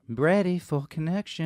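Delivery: slow, drawn-out mantra intoning. Pretend. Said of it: "Here's your mantra".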